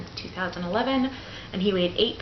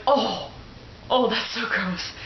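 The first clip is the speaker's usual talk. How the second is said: grossed out; 4 semitones higher, slower than usual. Retold or imitated. Mother watching the episiotomy in a mirror, higher and disgusted. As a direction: recoil in mock disgust. Retold reaction to scissors.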